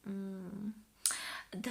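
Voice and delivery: normal talking voice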